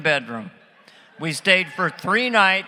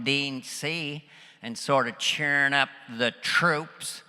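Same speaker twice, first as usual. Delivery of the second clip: George Bush; 2 semitones lower than usual. Retold or imitated. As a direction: check in like a folksy president, drawing words out. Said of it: "He was talking about his staff".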